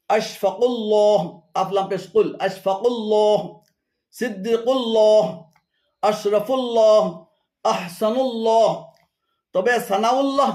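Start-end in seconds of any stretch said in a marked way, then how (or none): 0.1-8.7 "আশফাকুল্লাহ" said slowly as one word, "কু" linked; joined names. Shown as a demonstration. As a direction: link "কুল্লাহ" without a gap. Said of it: "শুদ্ধ উচ্চারণ হলো". (ধীরে "আশফাকুল্লাহ" একটানা)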